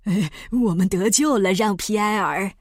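mildly jubilant, in a character voice; a touch of overjoyed relief; cheering with relief. Acted. Doctor to pilot on being saved, cheering. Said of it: "杰弗里斯欢呼着".

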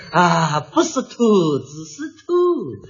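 slow, low dialect voice drawling 不是秃子; a hint of a dialect speaker correcting himself; 方言口音慢慢解释; local dialect accent. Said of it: "那个人还解释".

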